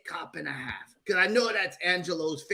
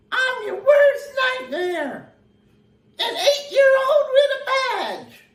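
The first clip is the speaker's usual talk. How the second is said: put-on high, slow little-kid voice with a tearful edge; child cop voice; 6 dB louder, slower than usual. [name]'s puppet line for the movie game, in a little-kid voice. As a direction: squeak it out like a tiny tough kid cop. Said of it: "You played that realistic, [name]".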